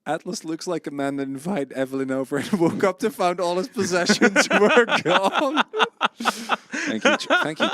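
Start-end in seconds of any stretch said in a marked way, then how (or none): 2.5-5.6 laughing through the words; cracking up mid-sentence. Real, not acted. Laughing at chat message. 6.2-7.7 high laughing thanks; giggling out a thank you. Real, not acted.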